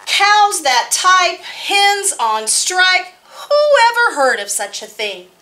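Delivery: put-on lively read-aloud for kids; story narration. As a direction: tell it brightly to a room of children. Reads picture book aloud.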